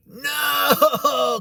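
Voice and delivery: high, anguished drawn-out "no"; re-enacted sobbing cry; crying wail. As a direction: wail it as if breaking down in tears. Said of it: "I just broke down and cried even more".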